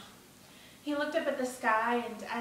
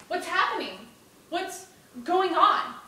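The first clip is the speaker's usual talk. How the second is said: raised, higher voice in rising alarm; alarmed boy; 7 semitones higher, 7 dB louder than usual. Acted. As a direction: demand answers, drawing out the second question. Boy alarmed as soldiers surround them.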